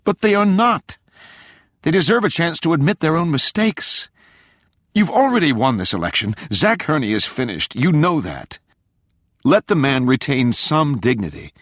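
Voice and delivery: high, forceful, urgent appeal; indignant, pleading insistence; heated plea. A narrator's reading. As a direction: argue passionately with your father. Daughter pleads heatedly with father.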